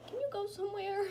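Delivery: high, loud coaxing of a pet; dog talk. Real, not acted. Coaxing her dog, repeated.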